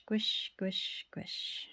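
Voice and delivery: fading whispered squish. A narrator's reading. Narrator voicing the brush for listening kids, fading whisper. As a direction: whisper it and let it die away.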